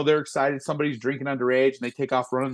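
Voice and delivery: usual speaking voice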